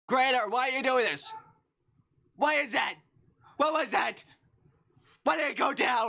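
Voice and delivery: high, loud protest at the creator; distressed shouting. Real, not acted.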